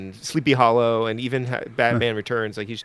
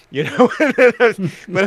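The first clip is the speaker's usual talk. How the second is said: laughing; 8 semitones higher, 8 dB louder than usual. Real, not acted. Laughing retelling Walken scare.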